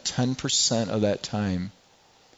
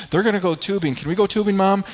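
put-on higher, excited child begging; eager kid; 7 semitones higher, 4 dB louder than usual. Preacher imitating a kid asking mom to go tubing, eager. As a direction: plead with mom like a keen kid.

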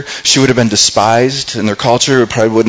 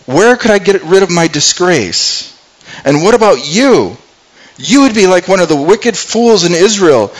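retold plea of a frightened sister; pleading; 7 semitones higher than usual. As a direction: beg him to stop, voice climbing high. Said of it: "she's, like, going".

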